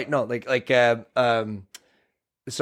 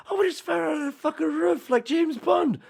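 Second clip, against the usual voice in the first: high-pitched quoted film bickering; movie character rant; 9 semitones higher than usual. Retold or imitated. Host quotes Boondock Saints, exasperated and high-pitched.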